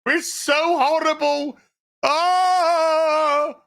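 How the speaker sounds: high, whiny fake wail; hammed-up sobbing lament; mock crying. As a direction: bawl theatrically, stretching out the ahs. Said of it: "have a good old cry".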